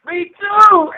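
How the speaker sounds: high, loud laughing reply. Real, not acted. Laughing along at his friend's story. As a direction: laugh it out, tickled.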